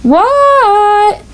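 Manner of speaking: high, drawn-out answer; called reply. A narrator's reading. Froggy answers his mother's call, drawn out. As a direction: shout back from the bedroom.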